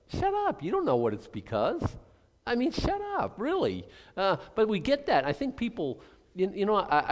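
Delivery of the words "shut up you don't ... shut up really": exasperated shut up. Real, not acted. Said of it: "preacher rebukes the judgers".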